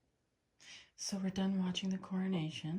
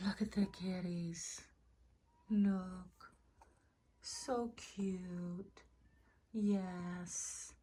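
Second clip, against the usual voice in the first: whispering; 4 dB quieter, much slower than usual. Real, not acted. Cooing whisper to cats.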